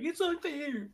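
whiny fan. Retold or imitated. Cohost mocking rival Macon fans, high soft whine. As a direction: pout it like a sore loser fan. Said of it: "fans who are like".

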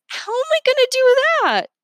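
very high, loud wail; high whiny wail; wailing dismay. A narrator's reading. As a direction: cry out helplessly.

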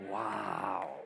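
ordinary talk, nothing marked

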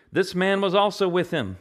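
put-on a kid blurting out the truth; mock tattling child; servant girl blurting it out. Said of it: "as kids are wont to do".

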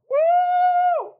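high shouted woo of a crowd; excited woo. Retold or imitated. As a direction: whoop like a thrilled congregation. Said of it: "you know the response".

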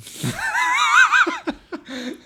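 shrieking burst of laughter; loud high laugh. Real, not acted. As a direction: howl with laughter. Laughing at X-Men answer.

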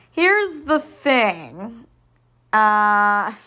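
slow, giggling through it; laughter breaking in; stifled giggles. Real, not acted. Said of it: "trying not to laugh".

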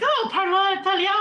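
high-pitched, excited Italian question; imitating an eager Italian speaker; delighted Italian. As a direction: exclaim it with Italian flourish. Imitates singer switching to Italian.

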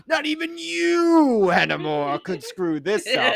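very high, loud, slow mock boss; pompous boss. Boss warning Hennemore, slow high hammed-up declaration. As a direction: stretch it out with grand disdain. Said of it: "he just goes".

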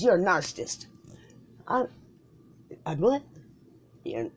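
usual speaking voice

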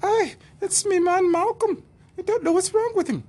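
very high, loud mock woman's voice. Woman begging the flight attendant, high mock voice. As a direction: squeal it like a fussy wife.

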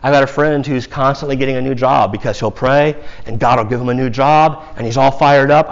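ordinary delivery

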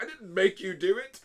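loud, high mock-angry protest; Lucifer getting mad. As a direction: snap it out in hammed-up indignation. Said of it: "And he'll get mad".

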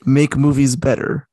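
usual speaking voice

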